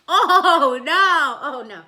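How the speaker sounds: loud hammed-up gasp of horror; mock horror. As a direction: cry out in pretend fright.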